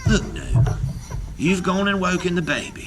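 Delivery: put-on hurry, feign the baby's woken; hasty Una. Una hushing the giant over the baby, hasty. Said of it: "said Una hastily".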